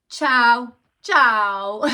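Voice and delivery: loud, bright friendly "ciao" call; cheery greeting. Shown as a demonstration. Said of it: "ciao is really informal".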